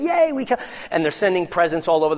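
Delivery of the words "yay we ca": high, gleeful mock cheer of the gloating enemies. Enemies gloating over the bodies, high mock cheer. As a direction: squeal it like a taunting crowd. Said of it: "they're saying".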